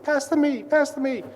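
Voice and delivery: high, loud mock kids' plea; calling out for a pass. Thirteen-year-old boys to their coach on court, calling out. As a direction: imitate rowdy boys yelling for the ball.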